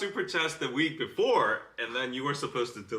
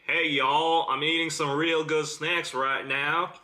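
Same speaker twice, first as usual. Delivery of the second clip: hammed-up Southern American; Southern accent. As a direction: put on a hearty Southern twang. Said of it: "please say this in a southern accent".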